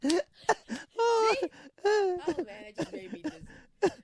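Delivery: very high, worn out from laughing; breathless with laughter. Real, not acted. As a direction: squeak it out after a laughing fit. Recovering from a laughing fit.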